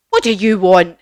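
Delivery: loud, imposing demand; big face on a screen, loud; Wizard of Oz. Acted. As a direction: boom it at the visitors. Wizard's big face addresses visitors.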